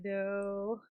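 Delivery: ordinary talk, nothing marked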